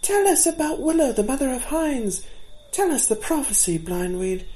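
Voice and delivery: put-on a young fawn begging for the story; pleading fawn. A narrator's reading. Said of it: "said the fawn that had spoken first".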